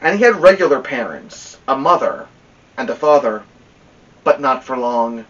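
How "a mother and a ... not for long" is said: usual speaking voice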